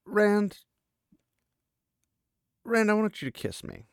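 high, halting call of his name; bold request. Retold or imitated. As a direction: call the name high, pause, then ask. Girl makes her bold request.

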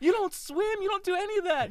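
very high, loud comic rebuke; mock exasperation. Picking apart his friend's story, mock exasperation. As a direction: shriek each objection in hammed-up dismay. Acted argument over false story.

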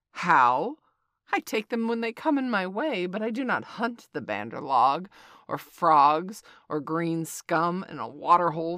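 loud offended snake; indignant. A narrator's reading. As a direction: rise up in scornful outrage. Kaa indignant.

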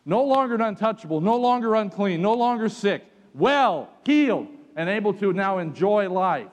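triumphant sermon climax; preaching at full force. Acted. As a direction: ring it out from the pulpit.

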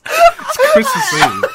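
loud, slow laughing holler; excited shout. Real, not acted. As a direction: belt it out with a laugh. Festive exclamation, laughing loudly.

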